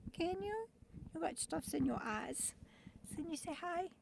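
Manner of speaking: high, soft, fast coaxing. Real, not acted. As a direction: coo at the dog. Coaxing dog to greet viewers.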